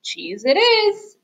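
high, drawn-out beetle voice; stretched-out little bug proclamation; buzzing beetle. A narrator's reading. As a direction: slowly grant the wish up high. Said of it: "buzzed the beetle".

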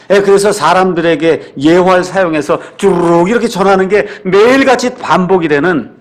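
ordinary talk, nothing marked

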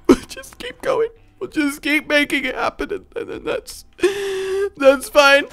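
high, quivering fake-crying voice; mock weepy voice. Player acting a heartbroken character, high weepy whine. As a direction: whine it through pretend tears.

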